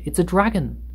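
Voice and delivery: high-pitched frightened exclamation; name the monster in alarm; alarmed cry. A narrator's reading. Policemen see the centipede's face, crying out. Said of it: "they cried".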